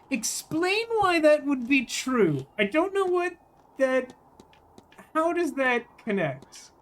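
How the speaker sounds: high, slow, incredulous demand; mock exasperated questioning. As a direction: press the point in a put-on baffled voice. Questioning game character aloud.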